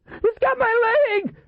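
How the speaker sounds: slow, drawn-out high scream; high-pitched shriek of terror; terrified scream. A narrator's reading. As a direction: scream as if seized from below. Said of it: "Duffy screamed".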